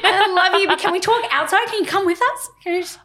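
high, laughing plea; strained high politeness, played for laughs; urgent pleading. Pleading with a friend to leave.

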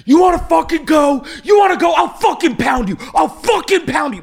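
high, loud mock tough-guy shout; macho threat. The driver from Montana threatening her, mocked as a loud high yell. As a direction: ham up an angry bro yelling threats. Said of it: "He goes".

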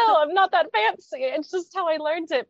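high, loud retold protest; mock protest. Student defending herself to teasing Egyptians, mock protest. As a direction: protest it in comic exasperation.

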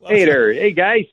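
high, hammed-up Chicago accent. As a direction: cheer it like a Chicago superfan. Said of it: "especially when you do the Chicago accent".

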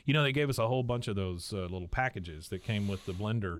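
ordinary talk

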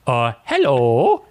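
Adam Sandler voice. Pretend. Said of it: "I can't keep doing the Adam Sandler voice".

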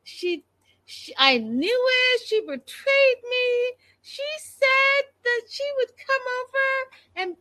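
high, wailing mockery of a sobbing wife; imitating the betrayed wife in a shrill, high wail; mock crying wail. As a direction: whine it way up like a hammed-up meltdown. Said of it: "this whole thing that she was doing".

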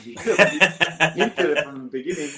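can't stop laughing at how he was played. Real, not acted. Telling a friend about the bosses' trick, laughing.

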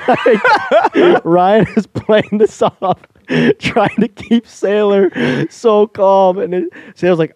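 high, loud and slow through laughter; cracking up while telling it; laughing. Real, not acted. Retelling a friend's calm act to a friend, laughing hard.

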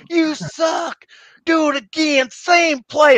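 high, loud coach yell; coach shouting. Retold or imitated. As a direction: blast it at the player like an angry coach. Coach yelling at scout team.

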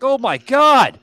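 loud shocked oh my God; shocked exclaim. Retold or imitated. To the kids after hitting his head, a loud shocked cry. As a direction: blurt it out like you just hit your head.

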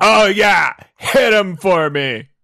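high, loud dopey thug; dopey cartoon bellow. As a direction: bellow eagerly like a dim brute. Bodyguard voice.

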